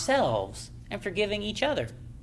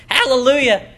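fervent hallelujah; 4 semitones higher, 8 dB louder than usual. Real, not acted. Preacher exclaiming praise.

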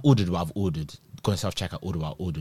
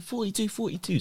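high mock announcer call; sing-song number call; imitating a worker calling over a speaker; 10 semitones higher, 8 dB quieter than usual. Staff calling number again.

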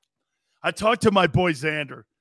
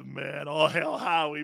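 normal talking voice

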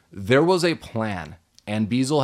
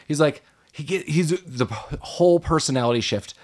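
ordinary talk, nothing marked

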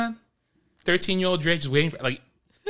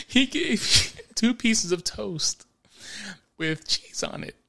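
ordinary delivery

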